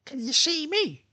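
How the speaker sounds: very high put-on female voice; high-pitched imitation of a woman asking; imitating ex-girlfriend. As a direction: mimic her question way up high. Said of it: "And she goes".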